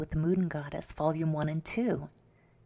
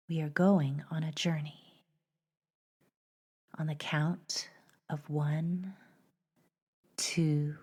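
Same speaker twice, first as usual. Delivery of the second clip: measured meditation voice with long pauses; unhurried, even guided-journey narration; 3 dB louder than usual. Acted. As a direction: gently lead listeners inward, leaving space between phrases.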